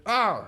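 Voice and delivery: high, loud stabbed cry; a sharp shocked ah; pained yelp. Retold or imitated. Character getting stabbed, high loud cry. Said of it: "And he's like".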